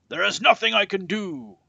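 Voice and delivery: high, loud angry shout; furious king shouting. A narrator's reading. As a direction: yell it at the begging villagers. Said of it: "shouted the king".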